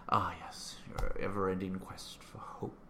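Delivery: slow whispered movie line; whispering a favorite line to a friend; hushed quote. Retold or imitated. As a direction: breathe it out in reverent awe. Quoting Magneto's line in awe.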